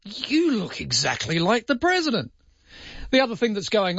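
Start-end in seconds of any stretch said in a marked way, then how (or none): 0.0-2.3 high-pitched imagined caterer; catering truck worker. Acted. Caterer on the truck meets the president, high-pitched acted voice. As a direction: play an awed caterer in a higher voice. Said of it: "somebody who got on that catering truck and said".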